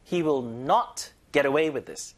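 slow, with not and away both punched; stress on not and away. Shown as a demonstration. Teacher drilling the phrase, not and away stressed. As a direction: lean hard on not, then on away. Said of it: "not에 힘주고 away 힘주고".

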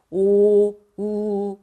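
"오" and "우" held long from the back of the throat; deep back vowels. Shown as a demonstration. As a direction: sustain "오", then "우", resonant and slow. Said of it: "목구멍 쪽에서 소리가 나죠".

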